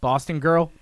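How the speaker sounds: normal talking voice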